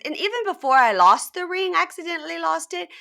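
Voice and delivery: high, loud imitated excuse; mock self-justification. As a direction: act out her excuse, pitched well up. Retelling her self-justification.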